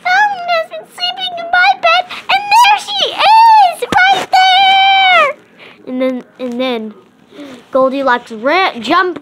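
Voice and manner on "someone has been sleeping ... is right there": very high, fast, loud cub yelling; the cub points and shrieks; shouting baby bear. Acted. Baby bear shrieks at Goldilocks, very high and loud. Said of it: "the baby bear said".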